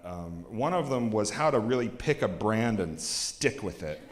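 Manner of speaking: ordinary talk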